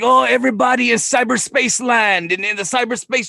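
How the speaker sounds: high, loud hammed-up wrestling promo voice; wrestler promo impression. Friend imitates a wrestler's promo for the podcast host, high and loud. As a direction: ham it up like a wrestler cutting a promo. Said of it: "He was like".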